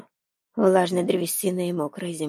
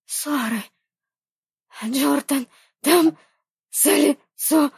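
gasping hoarse shout, much higher; hoarse cry; 8 semitones higher, 4 dB louder, slower than usual. Acted. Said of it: "с хрипом выкрикнула Рейни".

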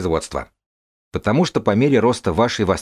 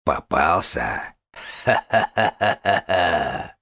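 sinister laugh; much slower than usual. Acted. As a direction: cackle like a trap-setting villain. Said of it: "зловещий смех".

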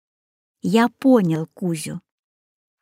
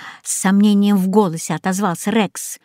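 ordinary delivery, nothing marked

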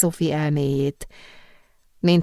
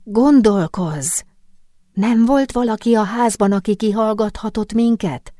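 insistent, pitched-up questioning; raised, pushing voice; urgent prodding; 6 semitones higher, 3 dB louder than usual. A narrator's reading. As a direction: press your friend hard to think. Urgently presses friend for answers.

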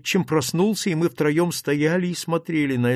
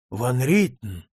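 ordinary delivery, nothing marked

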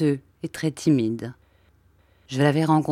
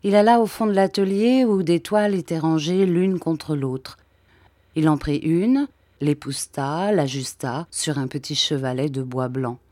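plain reading voice

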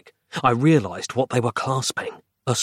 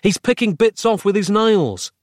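high, mildly raised voice; urgent yell; 9 semitones higher than usual. A narrator's reading. Narrator warning Roz of the recovering villain, yelling. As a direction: warn her with urgency. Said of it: "I yelled".

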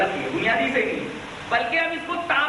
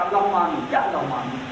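much lower, droning prayer imitation; mock supplication; 10 semitones lower than usual. Shown as a demonstration. Preacher challenges opponents' way of praying, low mock supplication. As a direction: drop low as if praying, then query it. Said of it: "اگر یہ دعا ہے تو یوں بولا جا سکتا".

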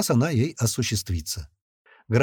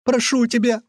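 much higher, tearful woman's begging; high-pitched weepy entreaty; tearful plea; 11 semitones higher, 3 dB louder than usual. A narrator's reading. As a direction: plead as a crying woman. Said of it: "заплакала женщина".

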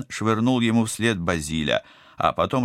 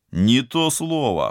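higher, louder, slow, pleased with himself; smirking; 7 semitones higher, 5 dB louder, slower than usual. Acted. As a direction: grin through the words. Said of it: "ухмыльнулся Теодор".

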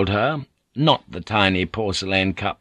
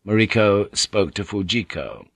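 ordinary delivery

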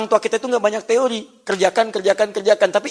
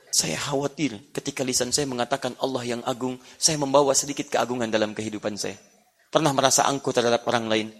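retold humble worry; 6 semitones lower, 5 dB quieter than usual. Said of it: "Kenapa kamu sampai bergetar menangis?".

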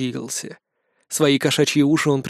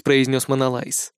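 plain reading voice

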